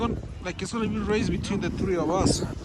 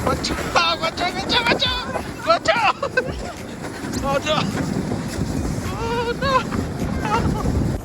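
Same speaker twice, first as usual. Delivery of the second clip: shouting warning; slower than usual. Real, not acted. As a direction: yell to clear the way. Racing sleds about to crash.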